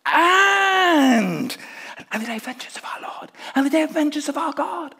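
breathless rush ending in a shout; crowd's eager prompt. Retold or imitated. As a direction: urge him on to finish the line. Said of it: "everyone's going".

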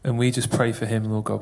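ordinary talk, nothing marked

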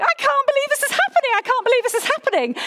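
high, fast, breathless excitement; giddy disbelief. Retold or imitated. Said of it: "my little heart was racing".

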